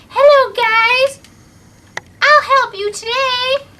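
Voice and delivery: very high, loud, slow sing-song creature voice; magical unicorn voice. Acted. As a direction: float it out like a sweet magic unicorn. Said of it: "It was like".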